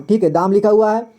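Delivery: usual speaking voice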